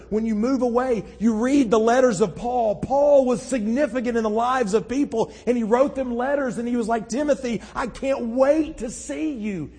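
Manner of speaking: high, loud, impassioned preaching. Acted. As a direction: preach it out with full conviction. Preaches at full force.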